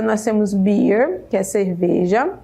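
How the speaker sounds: ordinary delivery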